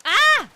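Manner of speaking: short, high, loud yelp; startled scream. Real, not acted. Streamer caught out by the game, startled scream.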